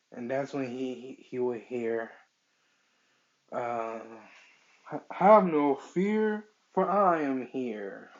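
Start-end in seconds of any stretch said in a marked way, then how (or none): 4.8-8.1 booming, high, drawn-out hero proclamation; All Might. Acted. Superhero calls out to the boy passing out, heroic shout. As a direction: announce yourself loudly and slowly as the rescuing hero. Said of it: "As he'll see All Might".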